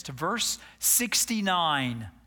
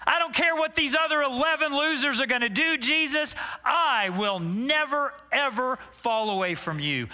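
pitched-up proud promise; Peter's bold boast; 5 semitones higher than usual. Acted. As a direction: swear loyalty like a cocky disciple. Preacher acting Peter's boast.

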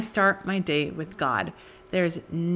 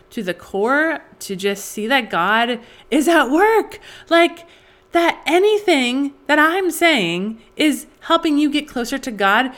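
higher and louder, gushing with emotion; overwhelmed with gratitude; 6 semitones higher, 4 dB louder than usual. Real, not acted. Host thanking a listener whose review moved her, rising overwhelmed. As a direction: let the thanks burst out, voice lifting.

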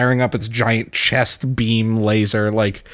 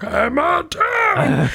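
very high, slow, stretched-out heroic cry; Reinhardt voice; much slower than usual. Retold or imitated. Reinhardt bursting out of the bus, stretched heroic cry. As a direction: bellow it long like a triumphant knight. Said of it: "He's like".

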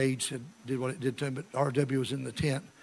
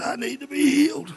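high-pitched imitation of an old man; pleading old man; 12 semitones higher, 6 dB louder than usual. Sick man asking preacher for healing, pleading.

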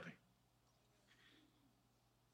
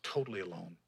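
"Totally alone" dropped low and much softer; quiet echo; 9 semitones lower, 12 dB quieter than usual. Shown as a demonstration. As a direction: let "Totally alone" fall to a hush.

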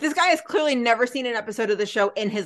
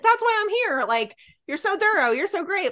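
lightly gushing, higher-pitched fan; mock an admiring patient a little; number one fan; 7 semitones higher than usual. Fan patient praising his doctor, lightly gushing. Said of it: "the hospital's number one fan".

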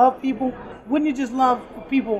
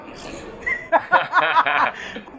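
long, higher-pitched laugh; 5 semitones higher than usual. Real, not acted. Worker asked how to be remembered, laughing. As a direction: burst into a drawn-out laugh.

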